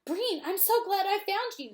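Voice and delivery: yelled. Acted. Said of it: "he yelled".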